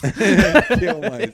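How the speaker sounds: high, loud, slow laughing wail; laughing mock despair. Joking reply with laugh.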